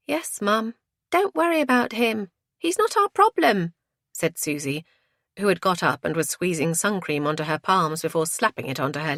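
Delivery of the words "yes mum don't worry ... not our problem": put-on high teenage girl, dismissive; breezy daughter, climbing higher. A narrator's reading. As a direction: brush Mum off in a high voice.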